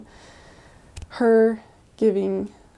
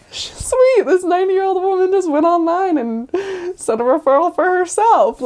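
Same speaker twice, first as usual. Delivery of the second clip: much higher, louder sarcastic cheer; excited amazement; 8 semitones higher, 6 dB louder than usual. Retold or imitated. Missionaries marveling at the ninety-year-old, higher and louder cheer. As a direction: gush in delighted disbelief.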